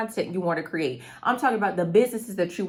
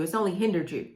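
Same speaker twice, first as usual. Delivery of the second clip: ordinary talk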